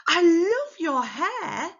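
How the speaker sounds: high, loud, slow gush with LOVE stretched; happy and excited. Shown as a demonstration. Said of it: "We sound happy and excited or surprised".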